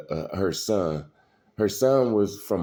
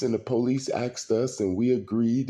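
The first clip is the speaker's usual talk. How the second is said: ordinary delivery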